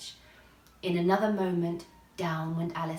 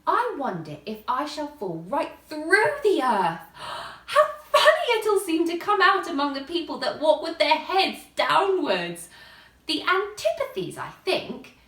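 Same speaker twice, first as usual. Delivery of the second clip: louder and higher, eager and amazed; excited wonder; 7 semitones higher, 9 dB louder than usual. A narrator's reading. Alice marvelling at falling through the earth, eager and loud. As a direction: let the idea run away with you.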